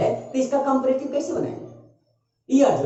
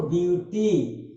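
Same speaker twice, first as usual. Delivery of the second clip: broken into syllables; 5 semitones lower, 3 dB quieter than usual. Shown as a demonstration. Teacher breaking a word up for students, beautifully in syllables. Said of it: "अब अगर इसको तोड़िएगा".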